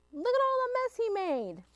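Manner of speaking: high exasperated exclaim. Acted. Teacher pointing the children to the moose's mess, exasperated.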